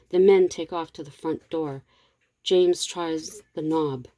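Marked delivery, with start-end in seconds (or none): none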